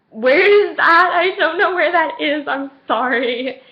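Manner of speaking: loud, high, drawn-out mock wail; whiny helplessness. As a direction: whine it out like you're hopelessly lost. Acted lost girl unable to find him.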